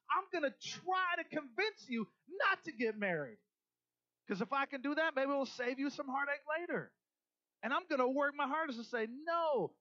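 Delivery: a pastor preaching hard on marriage; full-force preaching. Acted. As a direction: drive it home to the congregation.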